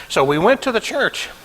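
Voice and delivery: normal talking voice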